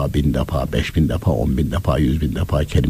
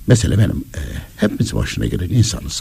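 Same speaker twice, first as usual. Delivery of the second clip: usual speaking voice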